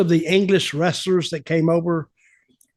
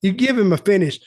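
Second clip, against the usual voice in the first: ordinary talk, nothing marked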